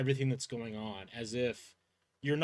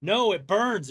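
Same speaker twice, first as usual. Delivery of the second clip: raised, higher cry of burning pain; pained outcry; 6 semitones higher, 8 dB louder than usual. Acted. Victim to her attackers as blood burns her, pained outcry.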